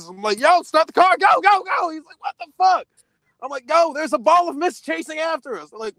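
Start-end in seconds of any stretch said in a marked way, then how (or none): 0.4-1.9 high, loud frantic urging; panicked yell. Retold or imitated. Panicked retelling, urging driver. 2.2-2.9 startled. Retold or imitated. Friend's retold startled reply. 3.7-5.7 frantic. Retold or imitated. Retold panic at driver.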